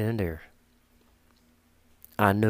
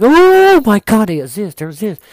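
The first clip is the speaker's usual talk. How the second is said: panicking cat; 8 semitones higher, 15 dB louder than usual. Acted. Cat freaking out inside over ghosts, mock panicked scream. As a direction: shriek as a hammed-up terrified cat. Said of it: "inside, she's like".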